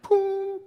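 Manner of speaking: high, drawn-out cartoon boom; imitated pop-up boom. Preacher mimes a genie popping up, high boom. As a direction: make a high magical poof noise.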